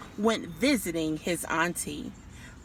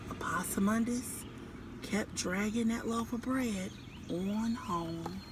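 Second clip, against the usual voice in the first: soft whispered storytelling; whispered read-aloud; hushed narration; 8 dB quieter than usual. A narrator's reading. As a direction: drop to a whisper for suspense.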